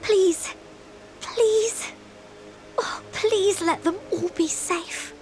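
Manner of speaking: high, tearful pleading; desperate plea. Acted. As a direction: beg as if close to tears, fearing for friends. Desperate prayer for friends' safety.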